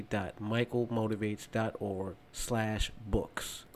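soft, measured promo read; sponsor read. Real, not acted. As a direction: read the book plug quietly and evenly. Recorded-style sponsor read, much quieter.

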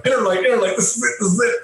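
high, rushed self-pep talk; nervous pep talk. Retold or imitated. Fan chanting to himself as the star approaches, nervous. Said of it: "I go".

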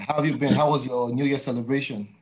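ordinary talk, nothing marked

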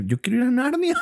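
high laughing yell; laughing shout. Pretend. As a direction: laugh while shouting the joke. Joking punchline, laughing shout.

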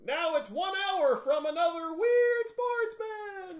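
high, slow announcer call; announcer impression. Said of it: "he's like".

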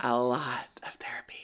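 catty whispered aside. Real, not acted. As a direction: whisper it behind a hand.

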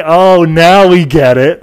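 loud, slow mock realisation; imitated Californians. Californians finally understanding his name, loud drawn-out realisation. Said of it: "They were like".

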